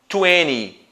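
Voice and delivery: twenty with a silent t, "tweny"; American-style "twenty" without the middle t; American pronunciation. Shown as a demonstration. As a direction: say "twenty" dropping the second t. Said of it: "no inglês americano é muito comum o som".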